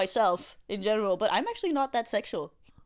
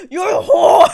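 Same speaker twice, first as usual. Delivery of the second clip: laughing, shouted high and loud; mock shouted insult; 8 semitones higher, 15 dB louder than usual. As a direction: yell it while cracking up. Comedian retelling her own heckle.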